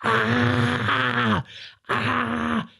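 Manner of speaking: high, retold scream at no extra volume; mock scream. Girlfriend screams from the windowsill into the dark, mock high shriek. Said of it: "just screaming like that".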